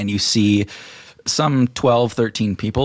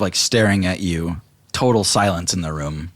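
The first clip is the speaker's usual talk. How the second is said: usual speaking voice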